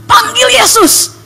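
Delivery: high, loud, slow urging cry; urgent remembered prompt. Retold or imitated. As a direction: call it out like an urgent command. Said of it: "ada orang berdoa".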